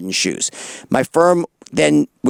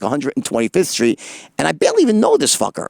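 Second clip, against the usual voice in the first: ordinary talk, nothing marked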